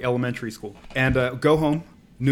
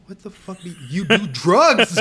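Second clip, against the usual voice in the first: laughing retort; 7 semitones higher, 7 dB louder than usual. Retold or imitated. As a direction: crack up while firing it back. Kid mocking dad, laughing.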